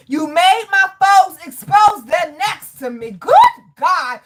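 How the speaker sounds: loud, rising sermon voice; building, triumphant psalm reading; full-force preaching. Real, not acted.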